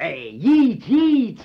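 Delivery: loud, rising shouts of the name; taunting the professor about Yeats; mocking shout.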